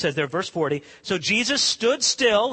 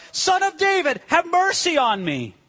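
high, acted plea; crying out insistently; 8 semitones higher, 3 dB louder than usual. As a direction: call out high, refusing to be hushed. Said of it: "he cried out all the more".

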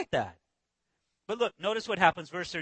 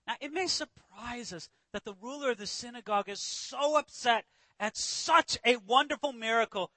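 ordinary talk

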